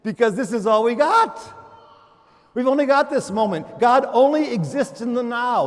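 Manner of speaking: loud, high proclaiming; fiery sermon proclamation; preaching at full force. Acted. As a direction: ring it out over the congregation.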